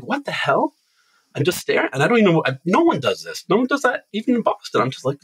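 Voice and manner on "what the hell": high-pitched, incredulous reaction; retold bafflement. Retelling reaction to being yelled at.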